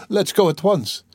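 high, hurried urgency; pitched high, eager to rush off; urgent. A narrator's reading. Sonia urges Raskolnikov to hurry, high urgent plea.

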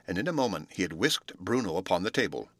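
ordinary delivery

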